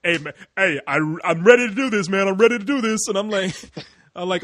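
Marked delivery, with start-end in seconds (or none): 0.0-3.1 louder, pumped mock eagerness; eager mock Stuart. Mimicking Stuart nagging to record, loud and eager. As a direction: imitate a friend itching to start. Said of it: "like, like".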